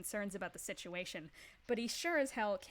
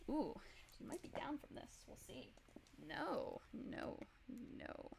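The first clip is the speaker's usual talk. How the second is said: hushed suspense; 4 semitones higher, 10 dB quieter than usual. Real, not acted. GM quietly checks damage.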